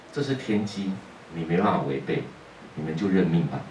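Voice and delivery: ordinary talk, nothing marked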